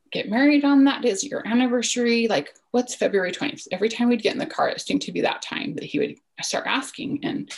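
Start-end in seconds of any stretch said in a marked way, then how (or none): 0.1-3.7 put-on higher, louder questioning as a child; imitating insistent son. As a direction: press like a curious kid. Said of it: "he would continue to ask".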